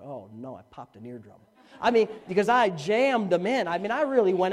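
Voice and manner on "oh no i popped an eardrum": low, very soft aside; hushed dismay. Retold or imitated. Youth pastor fearing he hurt the girl's ear, hushed dismay. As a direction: drop right down as if dreading it. Retells dismayed private thought.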